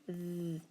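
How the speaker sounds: "Th" as a soft, buzzing voiced sound; voiced digraph sound. Shown as a demonstration. Teacher segmenting the word them, soft voiced "Th". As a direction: hold "Th" with vibration, no vowel. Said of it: "T H that's voice that makes".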